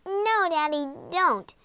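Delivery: high pleading cry. A narrator's reading. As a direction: cry out to stop him. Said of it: "cried Poppy".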